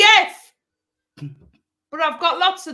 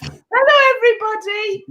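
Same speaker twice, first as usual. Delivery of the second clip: host greeting; 6 semitones higher, 5 dB louder than usual. Acted. Podcast host greeting listeners.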